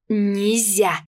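sharp, loud no; scolding. Acted. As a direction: scold like a stern nanny. Погрозила я пальцем.

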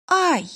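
high startled squeak of pain; squeaky yelp. Acted. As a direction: squeak out a sudden ouch. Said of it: "пискнула".

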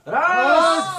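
shouting. Acted. Shouting someone out.

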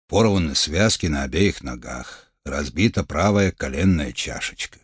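low, measured doctor voice; steady physician giving a diagnosis; calm healer. Acted. Healer reports injuries.

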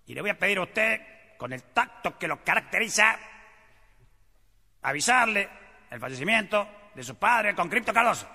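barking military officer voice; drill sergeant. Acted. General briefs sergeant, army joke.

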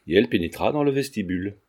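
ordinary delivery, nothing marked

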